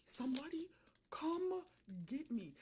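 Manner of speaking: ordinary talk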